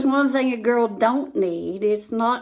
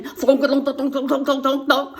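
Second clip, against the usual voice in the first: bumping thunks; 3 semitones higher, 4 dB louder than usual. Retold or imitated. Imitating being dragged over ground.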